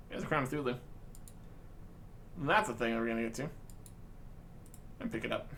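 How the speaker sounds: ordinary delivery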